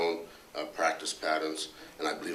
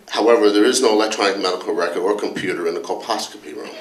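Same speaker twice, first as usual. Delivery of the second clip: louder, a touch indignant complaining colleague; Dr. Anderson; 10 dB louder, faster than usual. Retold or imitated. Colleague grilling the department head about hospital rules, mock indignant. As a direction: press the boss with mock exasperation, half-laughing. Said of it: "went something like this".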